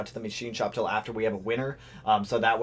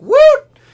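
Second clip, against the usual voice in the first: high loud woot; cheer; 18 dB louder than usual. Real, not acted. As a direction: whoop for the chat. Cheering on livestream.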